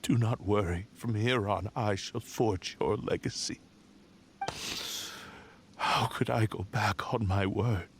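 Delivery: a quiet, grieving voice; hushed sorrow. Acted. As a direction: speak softly, almost to yourself. Blade spirit recalls its promise.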